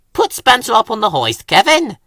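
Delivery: briskly bossy orders, quick. A narrator's reading. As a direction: boss the little crane about. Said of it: "he said bossily".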